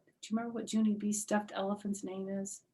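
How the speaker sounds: ordinary delivery, nothing marked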